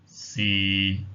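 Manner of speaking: "si" held low and level, Cantonese tone six. Shown as a demonstration. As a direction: keep "si" low and steady. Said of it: "low level tone".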